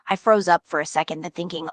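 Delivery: ordinary talk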